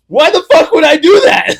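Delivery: high, loud wailing shout; hammed-up indignant shriek; horrified outburst. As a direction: yell it in appalled disbelief, imitating a friend. Retold outburst of horrified friend.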